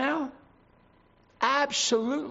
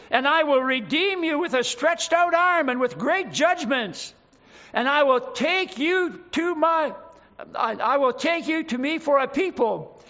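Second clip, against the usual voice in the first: louder and higher, proclaiming every phrase; preaching at full force; 4 semitones higher, 3 dB louder than usual. Acted. Preacher crying out God's promise to Israel, full force. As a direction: shout the promise over the congregation.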